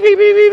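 high loud electronic beeps; shrill mock beeping; imitated phone beeps. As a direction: beep like a dying phone.

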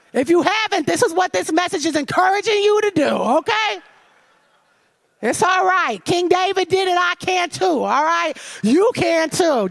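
rally the listeners, warmly insistent; preaching encouragement. Acted. Preacher urging the congregation to pray honestly, rousing.

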